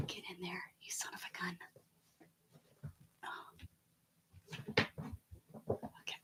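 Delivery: gritty whispered coaxing; hushed, playful scolding. Real, not acted. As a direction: whisper it at the stubborn object. Forcing an item in.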